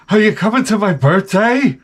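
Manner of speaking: high, put-on young coworker voice; exaggerated coworker impression. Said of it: "She doesn't talk like that".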